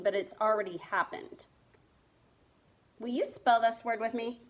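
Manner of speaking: normal talking voice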